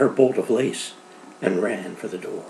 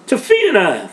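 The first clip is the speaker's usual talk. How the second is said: high, loud cheer of a toast; a crowd toasting the girl, loud and glad; joyful toast; 9 semitones higher, 8 dB louder than usual. Acted. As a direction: raise a glass and call her name out. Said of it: "they sang out".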